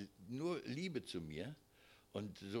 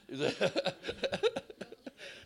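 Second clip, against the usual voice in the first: bright laugh, higher and louder; 10 semitones higher, 7 dB louder than usual. Real, not acted. Preacher laughing after quoting a loving remark, short high laugh. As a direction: laugh along warmly.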